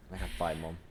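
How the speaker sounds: ordinary talk, nothing marked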